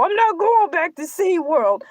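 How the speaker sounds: normal talking voice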